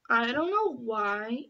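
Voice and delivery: normal talking voice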